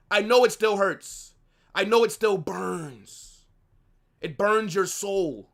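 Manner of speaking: ordinary delivery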